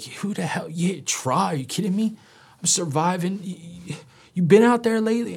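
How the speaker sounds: high, loud indignant outburst; I snapped at the counselor, indignant and loud. Retold or imitated. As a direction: fire back, offended and defensive. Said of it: "getting so indignant with him".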